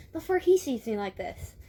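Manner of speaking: put-on tight-lipped girl; tight-lipped murmur. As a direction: talk through a nearly shut mouth. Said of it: "lip close to mouth".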